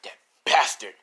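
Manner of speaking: loud burst of outrage; acted furious exclamation; angry curse. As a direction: spit out the insult with force. Son learns mother was taken.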